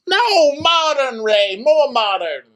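very high, slow, exasperated wife; Karen. Retold or imitated. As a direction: drag it out high and loud at your husband. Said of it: "it's Karen and Ray".

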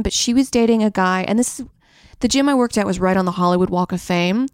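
usual speaking voice

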